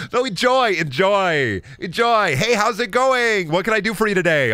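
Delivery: high, bright customer-service voice; sugary fake friendliness at the till; chipper cashier voice. Overly cheerful service voice.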